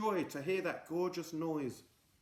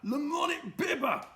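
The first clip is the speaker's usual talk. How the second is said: high triumphant cry; triumphant reveal; 5 dB louder than usual. Acted. Mister Gum revealing the plan to goblins, triumphant. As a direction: crow it out.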